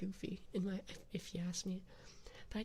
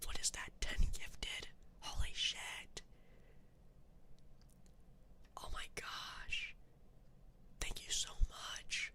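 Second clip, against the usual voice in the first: unvoiced whisper thanks to the chat; breathy whispered gratitude, close to the mic; ASMR whisper; 13 semitones higher than usual. Whispering thanks to donors on stream.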